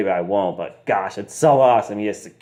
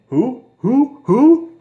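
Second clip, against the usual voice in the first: rising higher and louder each time; mock repeated who; 7 dB louder, much slower than usual. Belfort dodging his wife's question, climbing mock squeaks. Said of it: "Belfort just keeps saying".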